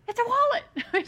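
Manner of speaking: put-on high, loud little-kid squeal blurting the secret; imitating a thrilled little girl spilling the gift; excited child's blurt. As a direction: burst out the surprise like an overexcited toddler. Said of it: "She was just so excited".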